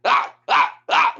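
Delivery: high, loud imitated grunts of a wrestler pumping himself up; imitated psych-up yells. Coach imitating his wrestler psyching himself up with high yells. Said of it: "He starts going, you know, like".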